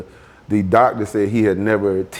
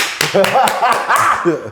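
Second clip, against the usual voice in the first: a choked, teary laugh; emotional laugh; 11 semitones higher, 3 dB louder than usual. Real, not acted. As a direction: laugh breaking into tears.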